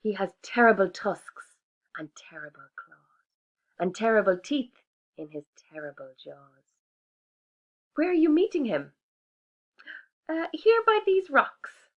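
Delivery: prim little mouse declining; polite little mouse. Acted. Mouse describing monster.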